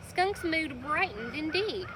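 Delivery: ordinary delivery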